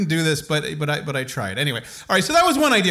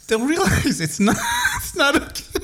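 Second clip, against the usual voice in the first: laughing; 12 semitones higher than usual. Real, not acted. Laughing through explanation.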